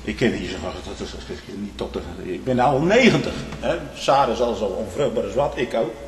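ordinary delivery

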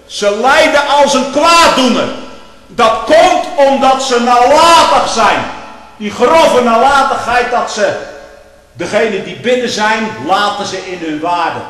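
loud, slow, high pulpit climax; preaching at full force. Acted. Preacher condemning negligent leaders, loud and slow. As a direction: thunder the condemnation slowly.

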